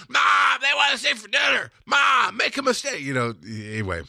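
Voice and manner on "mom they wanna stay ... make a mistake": yelling for Mom. Acted. Kid calling Mom about dinner guests, hammed-up yell. Movie bit, yelling to mother.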